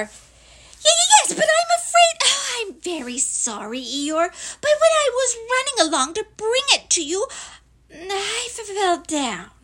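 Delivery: high, loud stammer fading as it confesses; squeaky, faltering small creature; distressed apologetic Piglet. Acted. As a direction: whimper the apology in a tiny high voice.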